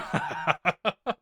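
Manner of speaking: a light, high giggle; high laugh. Real, not acted. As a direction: laugh at the joke. Laughing at the banter.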